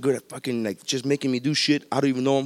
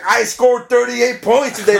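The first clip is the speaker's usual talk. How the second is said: higher, louder, slow brag; hammed-up LeBron bragging; mock boasting athlete; 7 semitones higher, 5 dB louder, slower than usual. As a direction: puff it up like a proud star. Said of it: "you don't fucking hear LeBron be like".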